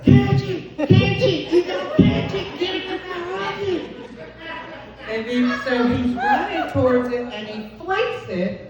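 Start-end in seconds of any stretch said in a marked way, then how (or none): 0.0-3.8 high excited shouts of catch it; frantic urging. Retold or imitated. Wife urging husband to catch the alligator, high and frantic. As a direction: urge him on in a panic. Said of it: "I said".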